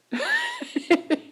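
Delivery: a very high burst of laughter. Real, not acted. Amused by enemies who smile most, high laugh.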